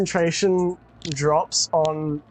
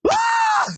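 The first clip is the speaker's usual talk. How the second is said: high shocked scream after a gasp. Acted. Jeffrey recognizing the scream, shrieking high. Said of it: "turn around at the scream and goes".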